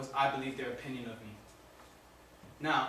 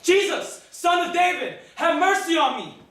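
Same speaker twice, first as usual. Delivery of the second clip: very high, loud cry for mercy; full-throated desperate plea; shouting out; 13 dB louder than usual. Acted. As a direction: yell it with all your courage. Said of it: "I shouted out".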